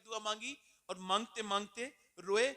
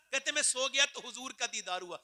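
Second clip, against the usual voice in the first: ordinary delivery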